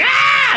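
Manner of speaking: high mock-terrified scream; screaming dude. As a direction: imitate his constant screaming, very high. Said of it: "that dude that's always going".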